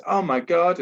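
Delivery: mock-excited Aussie gasp of wonder; Aussie accent. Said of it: "in an Aussie accent".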